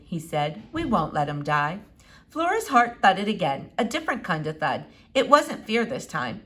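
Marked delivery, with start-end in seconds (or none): 0.7-1.8 low, gentle dad voice; a father calming his scared child; reassuring father. Acted. Father reassures Flora in a low gentle voice.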